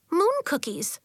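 high, bright and eager. Acted. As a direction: chirp it out happily. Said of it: "said Nori brightly".

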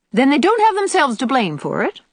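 high, triumphant comeback; triumphant retort. A narrator's reading. Old aunt winning her argument with Diana, triumphant. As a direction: score the point with a high swoop. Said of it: "retorted Aunt Atossa triumphantly".

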